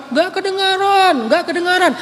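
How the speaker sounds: mock yell of listeners who can't hear; crowd shouting back. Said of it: "pada teriak-teriak di belakang".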